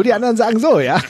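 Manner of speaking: laughing through the words, pitch jumping high; giggling while saying it. Real, not acted. Joke then laughter.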